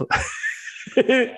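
breathy high-pitched laugh. Real, not acted. Laughing at Stan Lee.

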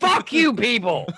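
sudden high, loud mock yell. Ranting about the Welsh being tricked, mock yell. Welsh grievance rant outburst.

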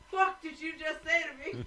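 imitated indignant reaction. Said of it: "When she's pissed".

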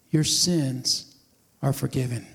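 low, slow and soft, like a blessing; gentle pronouncement. Acted. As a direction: lay the words down tenderly. Jesus speaks to Pat.

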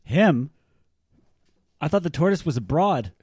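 high, very loud protest; loud disbelief. Acted. Son questioning what the tortoise is, loudly incredulous.

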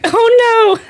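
high, loud mock gasp of shock. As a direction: imitate her shocked reaction, high and loud. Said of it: "she was just like".

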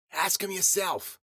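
put-on slow, high-pitched old croak; frog-in-a-stovepipe voice. A narrator's reading. Old woman points him to the culprit, slow high croak. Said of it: "Ruby had a voice like a frog in a stovepipe".

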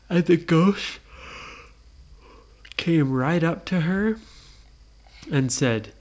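plain reading voice

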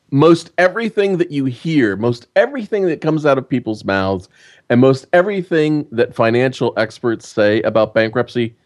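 ordinary talk

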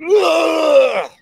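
very high, shouted "ugh"; mocking loudmouth noise. The guy answering my brother, high mocking yell. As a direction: blurt a mock yell, imitating an obnoxious guy. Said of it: "The guy's like".

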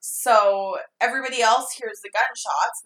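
usual speaking voice

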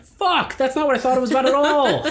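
high, laughing mock shock; laughing realization. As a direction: burst out laughing at your own mistake. Realizing song meaning, laughing.

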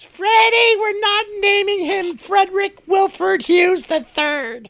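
imitating mom. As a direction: mimic mom overruling dad on the name. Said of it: "My mom goes".

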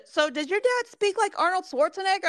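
a hint of a naive questioner; mocking ignorant question. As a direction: ask it like a clueless busybody. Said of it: "then they say this".

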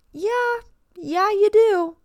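mock sing-song insisting. Mock insisting to whining swimmer.

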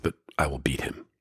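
hushed, determined whisper of a promise; whispered vow. Retold or imitated. David vows to his brothers to beat Goliath, whispering. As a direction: drop to a whisper on the final promise.

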